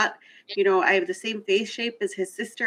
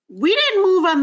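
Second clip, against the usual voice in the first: much higher and louder, indignant; indignant emphasis; 10 semitones higher, 6 dB louder than usual. Real, not acted. Offended speaker correcting a myth to the group, loud indignant emphasis. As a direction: rise up hard on it, offended. Emphatic point about the border.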